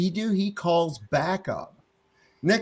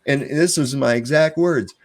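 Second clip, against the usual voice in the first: ordinary talk, nothing marked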